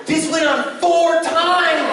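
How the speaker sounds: slow, high drawn-out aside; high, slow mock exasperation. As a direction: stretch every word. Deadpan comic aside.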